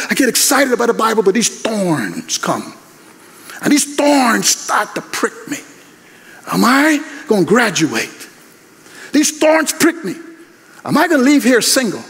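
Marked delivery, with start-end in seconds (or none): 4.5-12.0 preaching refrain. Acted. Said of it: "preacher builds refrain over music".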